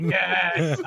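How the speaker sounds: high, gleeful cheer; acted triumph. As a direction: celebrate a winning kill. Acted celebration of the triple kill.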